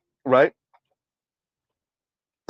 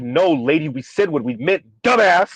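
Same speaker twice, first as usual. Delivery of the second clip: normal talking voice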